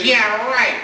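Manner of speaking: high mocking scoff; scoffing kid. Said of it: "Kid goes".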